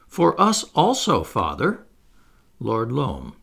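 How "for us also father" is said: a touch of pain in the voice; wincing. Acted. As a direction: admit it reluctantly, stung.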